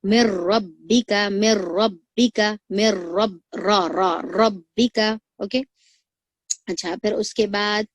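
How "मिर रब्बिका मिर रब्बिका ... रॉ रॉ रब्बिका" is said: मिर रब्बिका slow, louder, with a thick joined र; slow, louder recitation of रब्बिका; heavy r recitation. Shown as a demonstration. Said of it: "रॉ को मोटा ही पढ़ना रहेगा".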